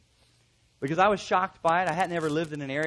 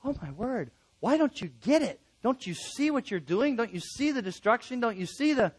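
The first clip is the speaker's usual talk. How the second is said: higher-pitched, incredulous pleading; exasperated thought; 6 semitones higher than usual. Acted. Helper thinking about the person he is helping, exasperated. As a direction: play exasperation at someone who won't listen. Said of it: "you'll just think".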